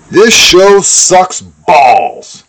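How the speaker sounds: high, drawn-out mock review; mock-scathing review. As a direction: stretch out every word of the insult. Reads out mock-insulting review.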